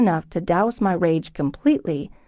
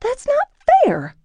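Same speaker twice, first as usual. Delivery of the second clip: much higher, slow, outraged protest; indignant cry; 3 dB louder, slower than usual. Acted. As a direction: cry out against the unfairness. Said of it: "I cried".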